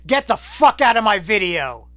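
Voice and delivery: hammed-up indignant outburst; mock outrage.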